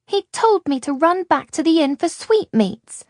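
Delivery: put-on a small girl accusing the man who left her; accusing little girl. A narrator's reading. Little girl to Luca and Ishrak, accusing. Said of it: "she said accusingly".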